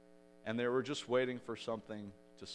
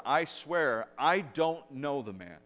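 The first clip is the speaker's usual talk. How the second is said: louder acted protest, slowing on the denial; boisterous louder denial; 4 semitones higher, 7 dB louder, slower than usual. Preacher voices Peter's second denial, boisterous and louder. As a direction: swear it loudly and emphatically, as if retelling. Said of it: "a little more boisterous and a little louder".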